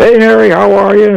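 high-pitched imitation of a friendly hail; acted-out cheerful hello; hearty greeting. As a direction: hail an old buddy, retold. Grandfather hails his old buddy.

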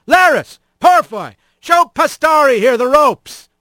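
high foreman's holler; calling out orders. A narrator's reading. Straw boss orders two haulers to train the newcomer, hollering.

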